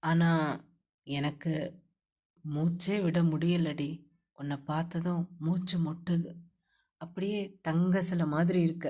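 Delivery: low, soft loving male voice; husband wooing his wife, low and soft; romantic husband. A narrator's reading. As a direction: murmur it low and tender to her.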